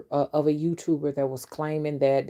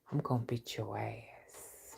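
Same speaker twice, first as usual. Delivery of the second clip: slow, soft threatening whisper; angry mother whispering a threat; menacing whisper; 4 semitones lower, 12 dB quieter, slower than usual. Retold or imitated. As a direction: hiss the threat quietly. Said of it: "When I feel like that time is coming".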